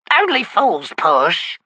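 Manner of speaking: slow, high hissing crone; hissed. Acted. Old witch scolding the wizard, slow high hiss. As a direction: hiss it out slowly like an old hag. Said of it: "hissed the old woman".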